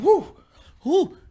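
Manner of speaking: short, high squeals; imitated "whoo". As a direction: mimic a squeamish onlooker's whoop. Imitates friend reacting while watching.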